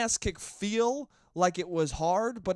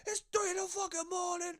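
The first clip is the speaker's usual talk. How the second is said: much higher, strained mock yell of an Italian dad; angry dad. Imitating his Italian dad yelling at him at night. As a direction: ham up the furious dad barging in. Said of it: "And he goes".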